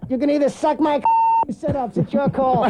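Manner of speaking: high, nasty puppet voice; George the puppet. Retold or imitated. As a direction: lay out a threat as a lewd dummy. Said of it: "the nastiest puppet".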